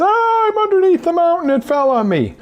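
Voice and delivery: high, loud panicked wail; absolute terror. Pretend. Coach acts out terror under the mountain, high panicked wail. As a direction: cry out as if buried alive. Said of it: "Absolute terror is".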